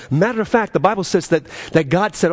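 ordinary delivery

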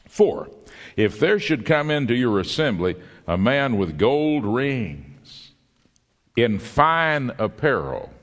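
slow, solemn reading of Holy Scripture. Acted. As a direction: read the verses with weight, stretching key words. Reads Scripture aloud.

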